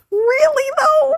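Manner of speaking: very high skeptical "really though"; mock a disbelieving sound guy; incredulous sound guy. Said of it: "some of them are like".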